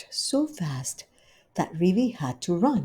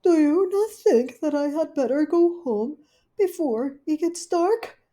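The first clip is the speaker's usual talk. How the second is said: higher, fretful lady dog; scheming Duchess; 11 semitones higher, 5 dB louder than usual. Acted. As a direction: plot it half to yourself. Duchess asks to go.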